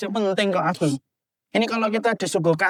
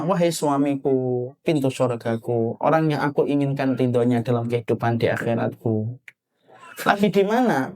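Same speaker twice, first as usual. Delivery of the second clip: sweet wife's message; 7 semitones lower than usual. Retold or imitated. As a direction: coax her husband sweetly. Wife's loving message to husband.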